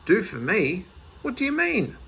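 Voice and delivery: high, sharp indignant question; Spink, indignant. A narrator's reading. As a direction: bristle at the offer, voice shooting up. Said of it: "said Spink".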